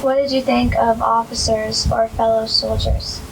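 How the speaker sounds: usual speaking voice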